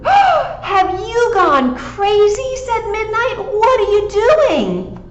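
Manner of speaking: high, slow, shocked cat voice. Acted. Cat scolding the digging dog, high and shocked.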